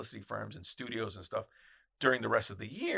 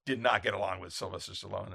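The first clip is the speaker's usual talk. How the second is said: ordinary talk, nothing marked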